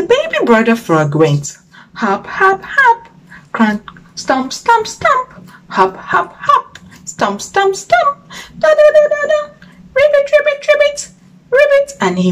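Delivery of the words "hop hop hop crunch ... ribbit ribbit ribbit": squeaky high chant of hop and stomp; playful high sound effects for children; high hopping noises. Acted. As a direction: bounce it out like a tiny frog.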